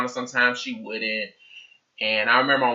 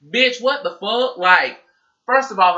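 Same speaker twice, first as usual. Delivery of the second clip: mock outrage; 7 semitones higher, 4 dB louder, slower than usual. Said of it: "I was thinking to myself".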